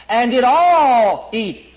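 "all" held long and loud, very slow; stress on all. Shown as a demonstration. Preacher reading scripture, stressing all. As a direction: pound on "all". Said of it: "Notice the all".

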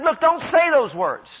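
high, insistent acted plea to stop; Caleb stilling them. Preacher as Caleb to the people, urgent admonishing. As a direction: raise the pitch to hush a crowd. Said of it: "he tried to still them".

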